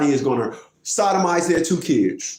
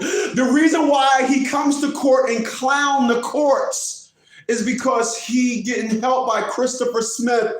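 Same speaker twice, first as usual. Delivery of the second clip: high, slow, emphatic quotation; mocking quote; 7 semitones higher than usual. Quoting the opponent's complaint about courts, high mocking emphasis. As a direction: read it out high and drawn out. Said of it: "and I quote".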